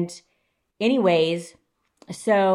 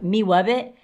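ordinary talk, nothing marked

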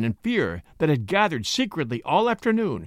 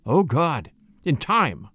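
usual speaking voice

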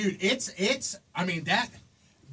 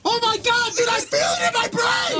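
excited shout; real yell of shock; 9 semitones higher than usual. Real, not acted. Reacting to smelling salts.

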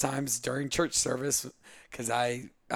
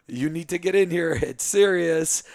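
put-on loud, raised-pitch order in a Brazilian accent; mother's Brazilian-accented yell; 6 semitones higher, 9 dB louder than usual. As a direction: imitate a Brazilian mom urgently yelling for her husband. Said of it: "yells out in her little Brazilian accent".

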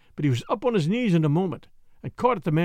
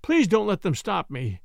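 ordinary delivery, nothing marked

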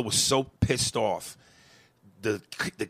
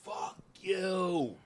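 half-voiced retold scream at the sky, pitched higher; 7 semitones higher, 10 dB quieter than usual. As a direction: recall yelling at God without full volume. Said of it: "I looked up at the sky and I screamed".

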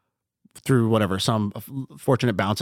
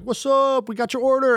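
high, bright hype-man hello; cheery webcam greeting; 12 semitones higher than usual. Retold or imitated. Greeting customers who just ordered over the webcam, in a high excited call. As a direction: pitch it way up like greeting a customer on camera.

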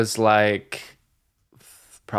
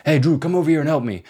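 higher, quick call, lightly voiced dad; imitated dad calling out; 7 semitones higher, faster than usual. Friend's dad calls boy over to help, imitated.

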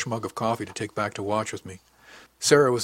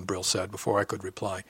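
ordinary delivery, nothing marked

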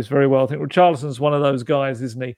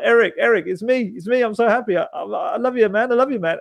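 higher, gushing with excitement; giddy teammate spilling his love; overjoyed gushing; 7 semitones higher than usual. Retold or imitated. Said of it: "It's almost like".

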